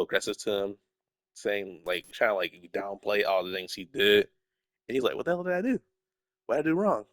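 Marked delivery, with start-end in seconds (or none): 5.2-7.1 higher, bewildered hero asking what he did; lightly acted indignant disbelief; baffled protest. Miles confused after being blamed.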